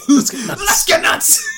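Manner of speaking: very high, loud, drawn-out yell; hammed-up mad shout. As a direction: scream it like a crazed movie line, laughing. Quotes favourite Joker scene line.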